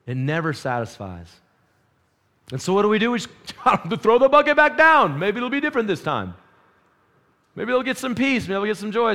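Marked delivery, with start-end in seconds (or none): 2.5-9.1 fervent preaching. Acted. Preaching on dropping buckets again.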